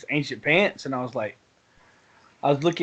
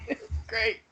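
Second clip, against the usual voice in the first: laughing; 6 semitones higher, 10 dB quieter than usual. Real, not acted. Still laughing.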